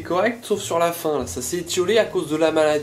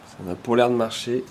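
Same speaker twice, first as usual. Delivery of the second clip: ordinary talk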